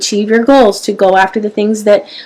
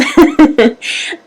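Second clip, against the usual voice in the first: brief laugh; 6 semitones higher than usual. Real, not acted. Laughs at own pep talk.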